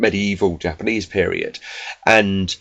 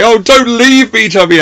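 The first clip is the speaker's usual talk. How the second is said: desperate pleading; 13 semitones higher, 8 dB louder than usual. Retold or imitated. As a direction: beg like a pathetic lovesick man. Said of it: "desperately say".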